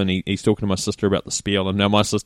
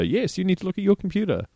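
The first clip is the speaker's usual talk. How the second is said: higher-pitched mimicking echo; parroting back; 10 semitones higher, 3 dB quieter than usual. Winding up the scammer by repeating them, mocking echo. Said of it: "repeat everything they say back to you".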